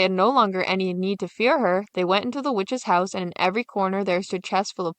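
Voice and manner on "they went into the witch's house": plain reading voice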